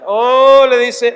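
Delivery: ordinary talk, nothing marked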